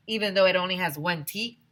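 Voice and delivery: ordinary delivery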